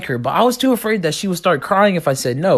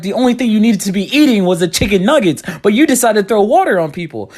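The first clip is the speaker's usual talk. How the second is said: mock scolding; 5 semitones higher, 4 dB louder than usual. Scolding friend after the fight.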